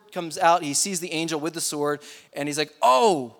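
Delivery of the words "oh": loud, high acted 'oh' of surprise; sudden realization. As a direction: play the startled discovery. Balaam suddenly sees the angel.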